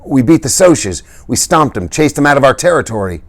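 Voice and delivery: breathless boast. A narrator's reading. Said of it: "Dally panted".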